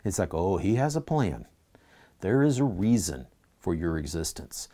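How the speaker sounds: ordinary talk, nothing marked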